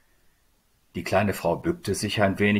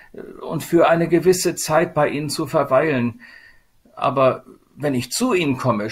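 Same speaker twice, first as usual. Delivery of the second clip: higher-pitched, lamenting voice of Sadness; plaintive Sadness; 6 semitones higher, 3 dB louder than usual. Acted. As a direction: lament like someone shunned by everyone. Figure of Sadness speaks.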